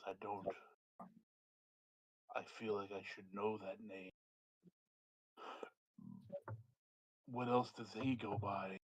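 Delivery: low rumbling dragon speaking softly. Acted. GM voices the dragon.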